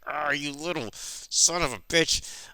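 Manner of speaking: hammed-up fury at held-back volume; mock angry. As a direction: act out an attacker's snarl, drawn out. Janice charges at Randy, attacking.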